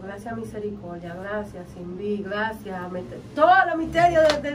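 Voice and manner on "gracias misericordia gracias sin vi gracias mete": murmured prayer of thanks, much softer; hushed grateful praying under the breath; thanking God softly on the beach; quiet prayer. Retold or imitated.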